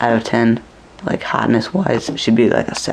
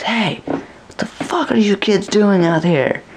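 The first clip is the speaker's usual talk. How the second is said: much higher, slow mock scolding; angry chaperone; 9 semitones higher, slower than usual. Chaperone catching the kids outside the mall, mock outraged. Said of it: "And he comes out and goes".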